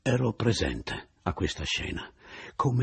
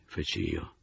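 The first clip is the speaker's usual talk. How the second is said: ordinary delivery, nothing marked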